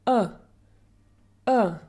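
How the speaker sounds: "a" as a very short neutral uh; isolated short "a", like uh; very short schwa. Shown as a demonstration. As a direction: clip "a" into a brief schwa, each one separate. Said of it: "like a very short sound".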